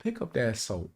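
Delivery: soft, menacing command; quiet threat. Retold or imitated. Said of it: "He'll be like".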